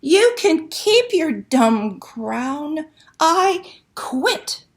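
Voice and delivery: a mermaid shouting that she quits. Acted. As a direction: yell it down into the pit, fed up. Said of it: "She shouted into the pit".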